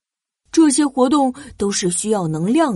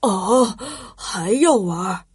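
surprised dad; 4 semitones lower, slower than usual. Acted. Father surprised.

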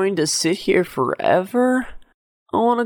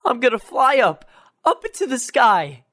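excited. Acted. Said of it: "said excitedly".

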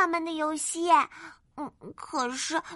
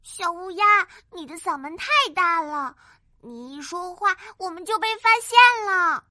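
complaining; 7 semitones higher than usual. Acted. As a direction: grumble at your friend. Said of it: "埋怨地". (埋怨的小兔子)

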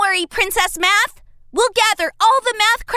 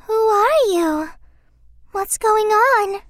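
ordinary delivery

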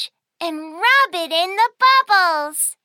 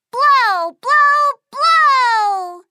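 louder, higher blow blow; loud playful blowing; 4 semitones higher, 4 dB louder, much slower than usual. Pretend. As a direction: cheer it like a game. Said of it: "bubble monster".

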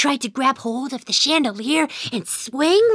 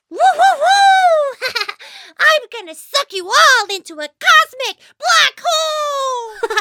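high, loud whooping space hero; gleeful play shout; 13 semitones higher, 4 dB louder than usual. Pretend. Young mouse playing space ranger on vacuum, gleeful high shout. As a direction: whoop and laugh through a pretend threat.

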